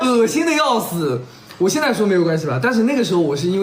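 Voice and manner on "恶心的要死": laughing disgust. Real, not acted. Recalling kissing after vomiting.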